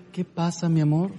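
soft, gentle answer to a grumpy husband; sweet wife reply. Pretend. Wife sweetly answering her annoyed husband, soft and tender. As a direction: disarm him with tenderness.

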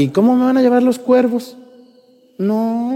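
high, incredulous mock objection; high skeptical question into a long no; doubting protest. Acts out the doubter questioning God.

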